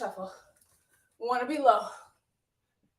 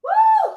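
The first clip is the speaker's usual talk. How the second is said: high, loud whoop of energy; excited woo; 13 dB louder than usual. Real, not acted. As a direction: let out a big cheering woo. Coach cheering mid-workout.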